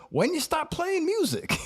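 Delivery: very high, slow puzzled question; Stone Man baffled. Retold or imitated. Stone Man puzzled he quit music, high and slow. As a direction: stretch it out, totally baffled.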